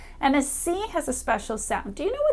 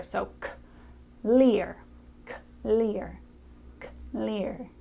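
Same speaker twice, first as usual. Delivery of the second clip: teacher blending sounds, separated at first; sounds blended slowly; 3 dB quieter, slower than usual. Shown as a demonstration. Teacher showing the child how to blend sounds. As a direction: say "k" and "leer" apart, then push them closer. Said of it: "put those two sounds together".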